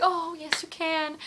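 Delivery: high-pitched, obliging imitation; playing a star happily granting a request. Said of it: "he was like".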